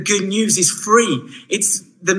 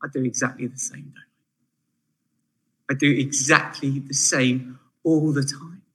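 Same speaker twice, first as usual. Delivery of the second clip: quiet self-realization; 6 semitones lower, 6 dB quieter than usual. Retold or imitated. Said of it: "take a step back and go".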